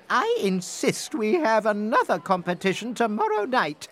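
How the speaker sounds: insistent queen. Acted.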